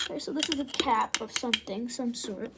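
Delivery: normal talking voice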